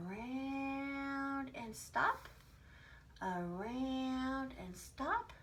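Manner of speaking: slow chant to guide drawing; teacher guiding children's pens, very slowly; very slow tracing. Shown as a demonstration. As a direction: draw each word out very slowly as the pen goes round. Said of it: "we're going to be drawing".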